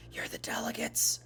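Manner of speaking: breathy stage whisper to the class. Acted. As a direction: lean in and whisper it like a secret. Teacher's dramatic aside to class.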